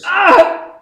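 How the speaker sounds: high loud yelp of shock; sharp startled cry. Acted. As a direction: jolt out a cry at the shot. Gunshot reaction.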